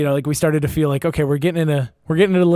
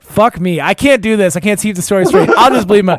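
loud, higher, exasperated; real flustered frustration, pitched up; flustered outburst; 5 semitones higher, 6 dB louder than usual. Real, not acted. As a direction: lose the thread and curse yourself, loudly. Flustered at botching the fake names.

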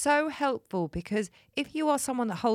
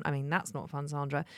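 ordinary talk

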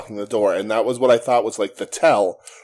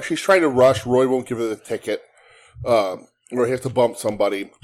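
ordinary delivery

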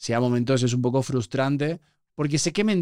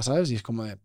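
ordinary talk, nothing marked

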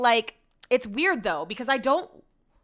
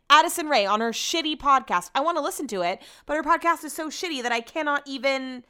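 ordinary talk

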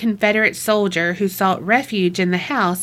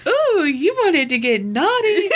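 playful mock flirting; 9 semitones higher than usual. Co-host teasing about a uniformed lover, mock flirting. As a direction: tease in a high, mock-naughty voice.

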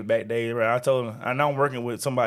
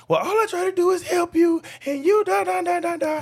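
much higher, nagging mock of the coworker; high mocking whiny voice. Mocking the annoying coworker in a high whiny voice. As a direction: whine it high like the nagging lady. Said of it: "So she was like".

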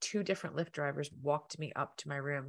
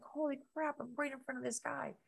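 awed; 10 semitones higher, 3 dB quieter than usual. Retold or imitated. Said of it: "I'm like".

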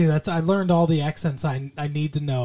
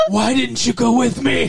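high, loud-ish hammed-up plea; mock dying friend; 5 semitones higher, 4 dB louder than usual. Dying Josh reaches out to friend, comic pleading. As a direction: wail it reproachfully while cracking up.

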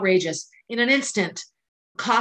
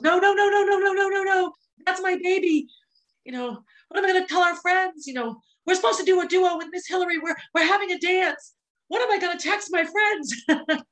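put-on much higher child's voice, a frantic string of no's; imitating a distraught child; 10 semitones higher than usual. Mother imitating her daughter's frantic denial, much higher. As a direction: play the panicked little sister refusing the news. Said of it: "her response is almost comical because she goes".

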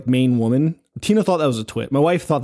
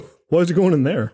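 quick, higher mock bafflement; confused viewer; 4 semitones higher, faster than usual. Mimicking viewer confused by character choices, quick mock bafflement. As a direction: imitate a puzzled moviegoer questioning the film. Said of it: "confused by, like, the character choices".

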